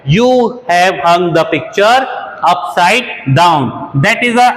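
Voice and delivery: very slow, broken into chunks with pauses; very slow, chunked repeat for learners; very slow chunked dictation. Shown as a demonstration. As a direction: dictate phrase by phrase. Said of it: "तो आपने तस्वीर को उल्टा टांग दिया है".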